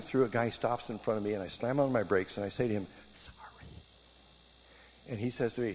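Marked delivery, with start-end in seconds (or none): 3.1-3.7 barely-there whispered sorry; hushed, voiceless little apology. Retold or imitated. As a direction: whisper it, almost no voice. Mimed apology to other driver.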